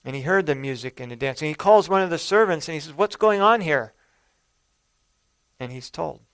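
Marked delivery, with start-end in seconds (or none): 2.9-3.9 loud, higher, pushy demand; demanding question. Acted. Older son loudly demands answers from the servant.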